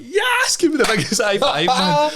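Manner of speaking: huge high yes; dad's cheer. Retold or imitated. Dad celebrating the news, very high cheer. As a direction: cheer it triumphantly.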